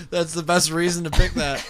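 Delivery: high, loud laughter into words; laughing. Real, not acted. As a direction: crack up, then get the line out. Cracking up at the answer.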